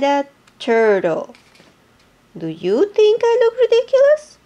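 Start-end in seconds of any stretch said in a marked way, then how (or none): 2.4-4.4 high, far hippo voice. Acted. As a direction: ask it as the anxious hippo. Hippo asks each animal.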